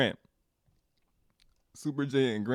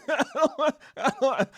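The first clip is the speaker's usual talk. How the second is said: laughing; 11 semitones higher, 4 dB louder, slower than usual. Real, not acted. Laughs at own stubborn pride.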